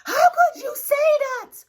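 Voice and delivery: high, loud, wailing mock outrage; imitating an offended insecure girl, crying out; mock wounded cry. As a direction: wail it like a hurt drama queen. Mocking an insecure person's reaction.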